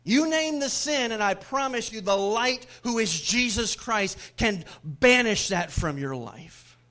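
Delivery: loud, high proclamation; preaching at full force. Acted. Preacher promising the congregation, loud and fervent. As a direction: call it out to the whole church.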